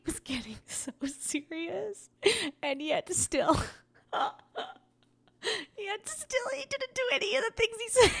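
giggling through the words, pitch shooting up; can't hold back the laughter; laughing while talking. Real, not acted. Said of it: "I can't stop laughing".

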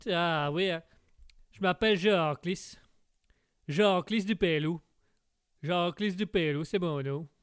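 put-on broad Québécois accent, drawn-out name. Québécois guest tells the host his name, broad Québécois accent. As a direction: introduce yourself in a thick Québécois accent. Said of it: "on vous appelle le Québécois".

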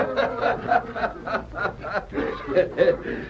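hearty roaring belly laugh; boisterous laugh. Acted. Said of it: "he heard a boisterous laugh".

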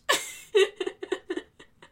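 high unvoiced cackle of laughter; laughing hard at her own joke; high burst of laughter. Real, not acted. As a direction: break into a real high laugh.

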